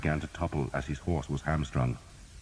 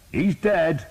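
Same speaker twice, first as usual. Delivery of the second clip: high, loud reply; shouting; 13 semitones higher, 7 dB louder than usual. A narrator's reading. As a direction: call it back across the fight. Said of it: "Thomas shouted".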